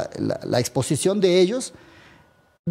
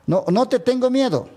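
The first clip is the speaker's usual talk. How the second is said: higher-pitched imitated challenge; retold defiance; 7 semitones higher than usual. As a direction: re-enact standing up to someone. Retold confrontation, defiant reply.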